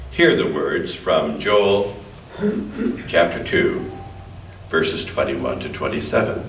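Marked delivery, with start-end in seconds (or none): none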